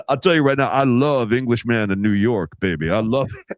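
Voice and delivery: imitating a deep-voiced reporter gushing; Mark Spears deep voice. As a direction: sink way down and savor it. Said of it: "He goes".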